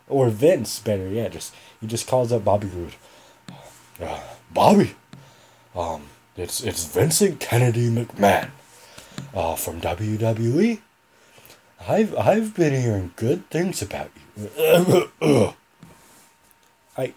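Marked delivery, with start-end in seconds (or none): 4.0-14.5 a lightly deepened, halting boss voice on the phone; terrible Vince McMahon impression. Vince phoning Bobby Roode to offer a deal, a weak impression. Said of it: "That, that was a terrible Vince McMahon impression". 14.5-15.5 throat-clearing cough. Shown as a demonstration. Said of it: "my throat needs to be more scratchy for Vince".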